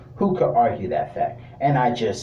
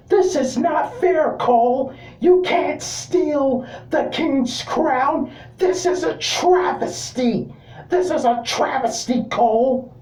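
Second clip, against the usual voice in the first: much higher, slowed whiny complaint at Cole; Corey Graves impersonation; 14 semitones higher, slower than usual. Retold or imitated. Commentator whining to Cole about the stolen crown, high and slow. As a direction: whine like a sore commentator protesting. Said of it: "I can't do a good impersonation, but I'm gonna try".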